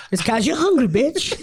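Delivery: loud cheeky comedian voice, laughing; Eddie Murphy. Retold or imitated. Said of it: "That's Eddie Murphy".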